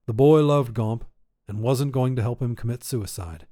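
ordinary delivery

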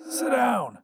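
high-pitched raspy order. A narrator's reading. Visitor tells his past self to sit, raspy. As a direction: rasp it, pitched well up. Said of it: "though a bit raspy".

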